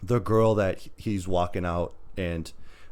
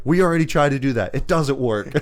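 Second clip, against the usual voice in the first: louder, higher retold objection; hammed-up exasperation, raised voice; mock exasperated protest; 6 semitones higher, 7 dB louder than usual. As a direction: imitate someone shutting down a bad idea. Acts woman objecting to him.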